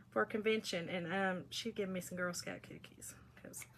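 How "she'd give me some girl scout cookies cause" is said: whispered aside. Real, not acted. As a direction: whisper it like a guilty secret. Confiding aside about cookies.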